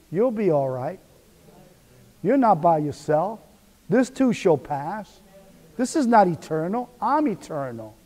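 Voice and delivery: soft, reassuring inner voice of God; God's soothing voice. Acted. Preacher voicing God to a worried listener, soft and reassuring. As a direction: comfort them quietly as God would. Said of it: "and that voice will tell you".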